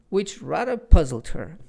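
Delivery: ordinary delivery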